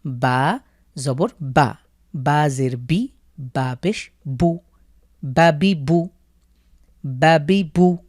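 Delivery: বা জবর বা spelled out slowly, word by word; slow spelled-out reading. Shown as a demonstration. Teacher showing learners how to spell out syllables slowly. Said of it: "যদি আপনি বানান করে পড়তে চান তাহলে এভাবেই পড়বেন".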